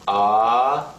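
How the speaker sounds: smoothly rising "ah"; second tone. Shown as a demonstration. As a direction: lift the pitch through "ah". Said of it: "The second tone".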